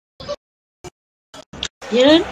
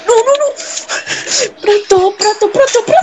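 high wailing panic; panicked urging; 5 semitones higher, slower than usual. Real, not acted. Gamer calling desperately to Prato during play, panicked. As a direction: plead with your player, voice rising high.

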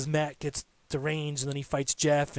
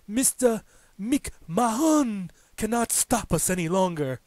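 put-on slow, grand Broken Matt accent, pitched up; 6 semitones higher, slower than usual. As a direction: drag it out like a pompous madman in Broken Matt's accent. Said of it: "He's like".

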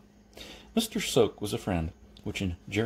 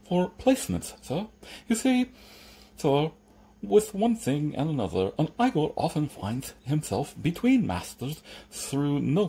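lisping servant, pitch raised, deferential; Igor; 6 semitones higher than usual. Acted. Igor explaining his agency to Jeremy, lisping and deferential. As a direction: explain delicately with a thick lisp.

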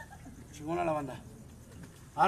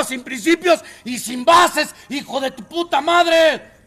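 fiery preaching; 5 semitones higher, 13 dB louder than usual. Real, not acted. Preaching at full force.

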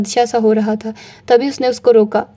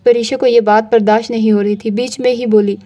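ordinary delivery, nothing marked

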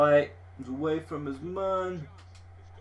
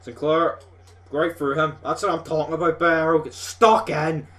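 louder, higher cheering of a good move; 4 semitones higher, 8 dB louder than usual. Real, not acted. Gamer hyping his team's attack on screen, loud and excited. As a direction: urge the players on with gusto.

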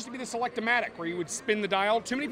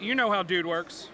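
ordinary delivery

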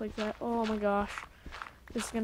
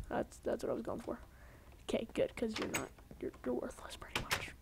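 whisper; 8 dB quieter than usual. Acted. Second voice mocks him.

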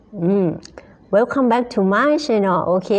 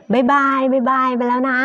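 higher, sing-song bye-bye; cheery goodbye; 5 semitones higher than usual. Real, not acted. Teacher signing off to her live viewers, cheery higher bye-bye. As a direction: wave them off with a lilting bye bye.

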